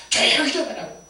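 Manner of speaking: loud, high shushing rebuke; imitated angry crowd hushing a beggar; scolding. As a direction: tell him off sharply and loudly. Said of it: "사람들이 저를 꾸짖으면서".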